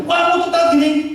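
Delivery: put-on high, nagging girlfriend voice; playing the cross girlfriend, pitched high; mock scolding girlfriend. Girlfriend scolds her late boyfriend, high and nagging.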